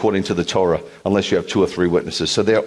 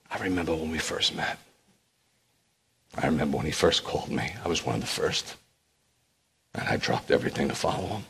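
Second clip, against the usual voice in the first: much softer, lower inner monologue; softly remembering; 4 semitones lower, 5 dB quieter than usual. Acted. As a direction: muse quietly to yourself, wistful. Peter reminiscing in his mind.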